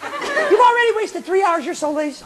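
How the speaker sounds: high-pitched little scold; jeering voice in the head; nagging inner voice. Acted. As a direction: needle in a small high voice. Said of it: "Your little boy says".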